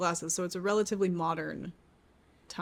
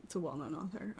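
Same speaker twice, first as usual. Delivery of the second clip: whispered to self; 3 semitones lower, 12 dB quieter than usual. Real, not acted. Guesser whispers the clue back to herself.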